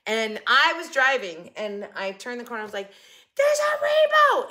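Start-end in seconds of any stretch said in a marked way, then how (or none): 3.4-4.5 high, loud thrilled cry; shrieking at the rainbow from the driver's seat; excited scream. Retold or imitated. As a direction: yelp at the sudden rainbow. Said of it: "they were making fun of me 'cause I screamed rainbow".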